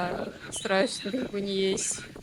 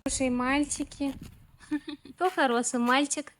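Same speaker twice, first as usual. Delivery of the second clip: talking to a dog; 6 semitones higher than usual. Real, not acted. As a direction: coo at the puppies, sweet and high. Cooing at stray puppies.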